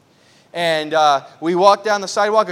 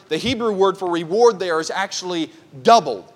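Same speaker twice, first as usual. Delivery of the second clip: usual speaking voice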